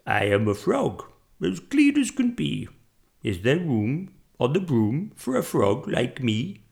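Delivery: storybook frog croak; put-on froggy voice. A narrator's reading. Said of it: "then said with a croak".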